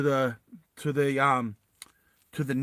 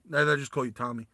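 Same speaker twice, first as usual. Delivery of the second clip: ordinary delivery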